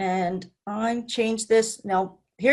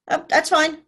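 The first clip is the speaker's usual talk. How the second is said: ordinary talk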